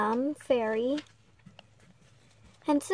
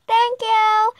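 excited; 8 semitones higher, 7 dB louder than usual. Acted. Mute girl finds voice.

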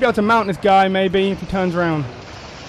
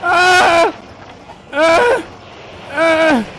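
panicked yelps; 10 semitones higher, much slower than usual. Real, not acted. Gamer under attack.

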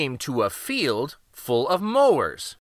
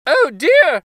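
"Oh dear!" cried much higher and louder, in alarm; the gingerbread boy panicking as he is bitten, "Oh dear!"; high, loud squeal of fright on "Oh dear!"; frightened cry; 12 semitones higher, 9 dB louder than usual. Acted. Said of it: "cried the gingerbread boy".

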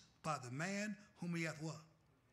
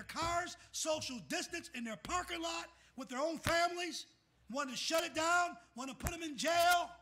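building from high to loud and much higher; full-force preaching; 8 semitones higher, 7 dB louder than usual. Acted. Pastor denouncing governors to his congregation, building shouted preaching. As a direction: hammer each question home, louder and louder.

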